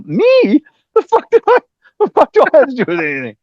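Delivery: very high, loud, rushed protest; indignant disbelief. Retold or imitated. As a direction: squawk in disbelief at being dragged in. Acts indignant disbelief at being blamed.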